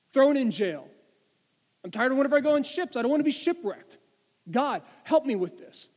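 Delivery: loud, high pleading prayer, building; desperate plea. Acted. Paul begging God to remove his problem, loud and desperate. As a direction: cry out to God, fed up and afraid.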